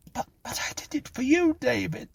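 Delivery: very high, desperate protest; stammering plea. A narrator's reading. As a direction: plead in a small high voice. Clara pleads with cursing David.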